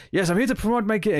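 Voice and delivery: bad French accent, pitched well up; shitty French accent. Podcaster quoting a game maker plugging his game, in shitty French accent. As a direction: do a hammed-up mock French accent. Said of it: "French, which is why I'm doing kind of a shitty accent".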